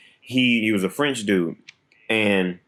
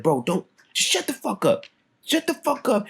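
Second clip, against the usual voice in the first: high exasperated shut-up; mock angry snap; 11 semitones higher than usual. Shutting Pierre up, a high exasperated snap. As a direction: cut him off, pitched way up.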